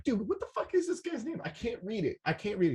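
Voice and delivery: high, puzzled call to a stranger; baffled question. Retold or imitated. Asking a guy in the pit the DJ's name, high and baffled. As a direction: ask it half laughing, high and bewildered. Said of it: "I was like".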